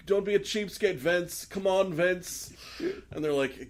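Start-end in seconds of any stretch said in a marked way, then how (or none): 0.1-2.5 [name]. Retold or imitated. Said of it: "he's like".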